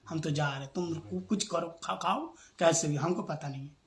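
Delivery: low, soft mock sulking; retold tearful sulk. Husband reports his wife's walkout to the guest, low mock sulk. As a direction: imitate a huffy wife withdrawing, quietly. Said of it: "पत्नी तो रूठ के चली गई रोती हुई".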